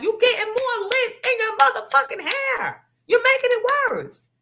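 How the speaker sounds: high, put-on mother scolding. Mother scolds the girl hiding under the bed, high-pitched scold. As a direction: nag the hiding child in mom's high voice. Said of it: "And she'd be like".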